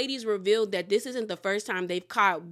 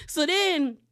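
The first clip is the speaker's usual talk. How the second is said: ordinary talk, nothing marked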